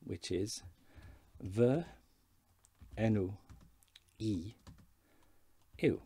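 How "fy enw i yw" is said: "Fy enw i yw" slow, each word apart; Welsh, word by word. Shown as a demonstration. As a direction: say "Fy enw i yw" as vuh en-oo ee yoo, pausing between words. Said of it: "We can use the formal way".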